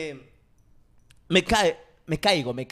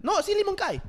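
mock waitress voice; 12 semitones higher than usual. Waitress insisting in retold story.

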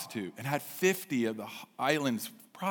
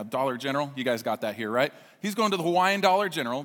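usual speaking voice

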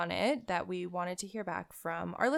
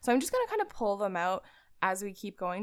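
usual speaking voice

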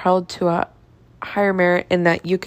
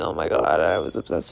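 exaggerated deep voice; 3 dB quieter than usual. Real, not acted. Confessing her old obsession.

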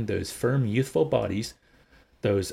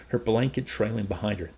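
ordinary delivery

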